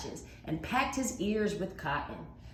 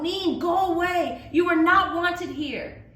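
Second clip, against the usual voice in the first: much higher, loud shout; yelling; 12 semitones higher, 9 dB louder than usual. Acted. Mai yells at the dragon to leave, loud and high. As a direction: drive the dragon off with your voice. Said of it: "She yelled".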